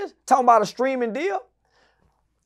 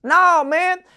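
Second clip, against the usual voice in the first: ordinary delivery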